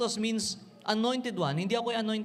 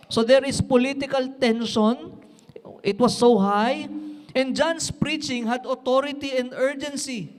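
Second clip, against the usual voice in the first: louder, driving preacher voice over music; fiery preaching; 5 dB louder than usual. Acted. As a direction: preach it with rising urgency.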